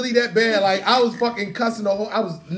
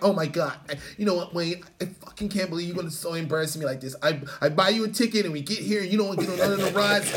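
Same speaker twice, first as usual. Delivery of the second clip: mock angry ex; 5 semitones lower, 8 dB quieter than usual. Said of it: "my ex was like".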